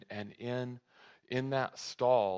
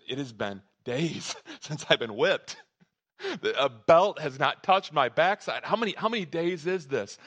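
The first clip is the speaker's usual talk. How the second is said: incredulous, higher-pitched inner voice; amazed boy; 5 semitones higher, 7 dB louder than usual. Acted. Son noticing dad's new kindness, astonished and raised in pitch. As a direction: marvel at it with a laugh breaking in. Boy's amazed thought acted out.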